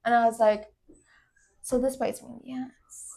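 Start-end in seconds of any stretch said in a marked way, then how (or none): 1.6-3.1 hushed, half-whispered grumble to oneself; very soft private thought; whispered aside. Retold or imitated. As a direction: say it under your breath. Retold private dismayed thought.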